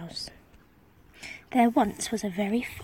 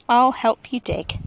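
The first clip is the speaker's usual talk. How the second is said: a hint of a bold king, louder; helpful king; 7 dB louder than usual. A narrator's reading. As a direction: offer help with gusto. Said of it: "said the hungry king".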